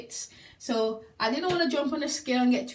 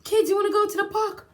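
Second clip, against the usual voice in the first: high, loud and quick, bursting with energy; a mum suddenly thrilled to take the kids out; excited invitation; 9 semitones higher, 6 dB louder, faster than usual. Retold or imitated. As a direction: call out to the kids like a fun mum. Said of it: "I'm like".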